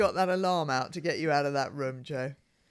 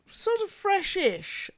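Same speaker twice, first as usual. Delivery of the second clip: high, drawn-out hedge; 13 semitones higher, much slower than usual. Acted.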